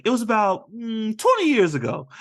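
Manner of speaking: high-pitched imitation of a boy storyteller; Squints. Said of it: "'Cause Squint starts".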